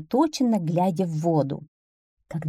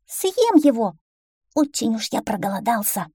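hungry little duckling; 5 semitones higher than usual. Acted. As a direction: declare it boldly as a hungry duckling. Hungry duckling boasts to fox. (голодный бойкий утёнок, высоким голосом)

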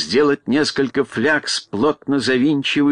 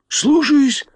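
high, eager servant voice; obedient servants; 11 semitones higher, 3 dB louder than usual. A narrator's reading. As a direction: answer the master in a high-pitched, put-on voice. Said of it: "в один голос ответили". (высокий голос покорных слуг)

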